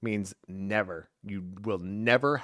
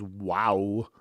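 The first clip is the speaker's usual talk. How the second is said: mock Walken wow; 2 semitones lower than usual. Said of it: "All I could say is".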